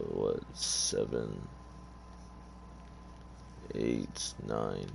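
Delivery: soft, low count under the breath; hushed self-directed counting; counting to oneself. Real, not acted. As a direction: count quietly to yourself, dropping the pitch. Counting slots to himself.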